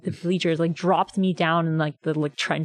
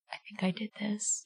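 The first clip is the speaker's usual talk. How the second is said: much softer, hushed confession; awed hush; 3 semitones higher, 12 dB quieter than usual. Retold or imitated. Fan hushing to her friend after the blackout, awed and much softer. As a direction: breathe it to your friend in disbelief.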